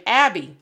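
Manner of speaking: Abby with the flat English a of "cat"; English pronunciation. Shown as a demonstration. Parent showing the English name her daughter gets called, English vowel. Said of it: "similar to the English name".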